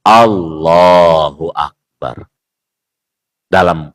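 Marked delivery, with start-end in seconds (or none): 0.0-2.3 slow, low Allahu Akbar with heavy L; takbir Allahu Akbar. Shown as a demonstration. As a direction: call the takbir slowly and deep in tone. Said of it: "takbir baru".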